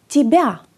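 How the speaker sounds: "τιμπιά" with i, falling; Russian pronunciation. Shown as a demonstration. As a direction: say "τιμπιά" as tibia. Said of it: "να πάει προς το ι".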